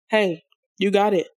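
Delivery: normal talking voice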